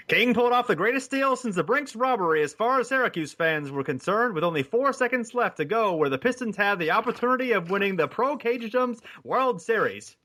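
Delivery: high-pitched vintage radio announcer; old-timey announcer voice. Acted. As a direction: read it like an old newsreel sportscaster. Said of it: "read part of it in my old-timey, um, uh, announcer's voice".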